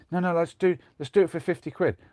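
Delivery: retold desperate insistence; imitating insistent gambler. Retold gambler pushing for rematch.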